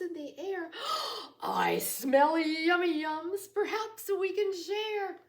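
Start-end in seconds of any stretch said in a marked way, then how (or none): 0.8-1.4 excited gasp. Acted. Badger sniffs air. 1.4-5.2 high, loud, greedy badger voice; eager badger.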